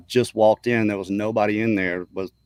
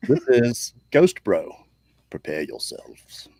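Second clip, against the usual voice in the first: dramatic show intro; slower than usual. Pretend. Host introduces Ghost Bro to listeners, mock-ominous and slow. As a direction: announce it slowly, then sink to an ominous hush.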